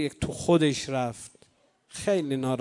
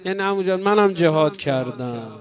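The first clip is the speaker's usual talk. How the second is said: lamenting recital; 5 dB louder than usual. Acted. Rawza lament narration building up.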